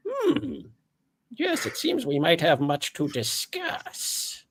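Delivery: very high, fast, formal monster voice; a high, prim floating orb speaking; orb creature voice. Acted. GM as Gregory the orb, high and formal.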